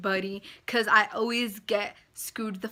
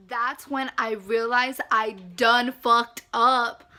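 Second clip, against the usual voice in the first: slow and loud realization; slow emphatic; 6 dB louder, slower than usual. Real, not acted. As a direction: hammer home the mistake. Realizing the scam.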